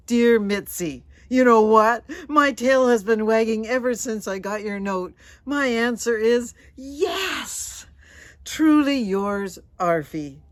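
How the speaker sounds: loud, bursting with joy; joyful dog letter. Acted. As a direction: shout it out like an overjoyed pup. Dog's joyful reply letter.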